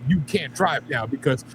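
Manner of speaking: ordinary delivery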